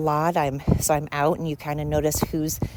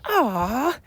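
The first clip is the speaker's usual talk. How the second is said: higher, sweet "aw, so nice"; touched aw; 10 semitones higher, 3 dB louder, much slower than usual. Retold or imitated. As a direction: coo it like it melts your heart. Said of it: "And I was like".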